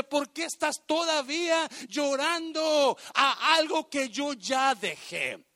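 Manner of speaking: high, loud, stretched-out challenge; God's rebuke. Acted. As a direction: preach it as an exasperated divine question. Preacher voices God's rebuke.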